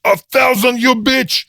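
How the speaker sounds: shout. A narrator's reading. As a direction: hurl it at the card sharp. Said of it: "Naumov shouted".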